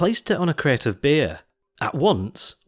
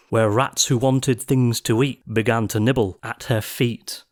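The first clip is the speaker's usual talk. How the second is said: ordinary delivery, nothing marked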